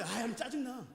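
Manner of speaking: high-pitched mock grumbling; irritated complainer. Acting a worshipper griping about the flawless offering, irritated. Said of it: "근데 이렇게 말해".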